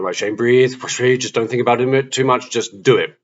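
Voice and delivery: retold pep talk, pushing yourself to act; self pep talk. Shane psyching himself up to face the bully, urgent pep talk.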